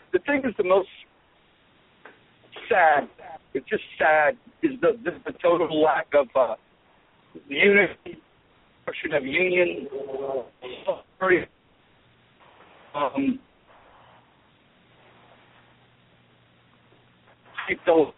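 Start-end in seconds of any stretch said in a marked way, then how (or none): 6.1-18.1 breaking up on the phone line; choppy cutting-out call audio; bad connection. Real, not acted. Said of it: "you're breaking up a little bit".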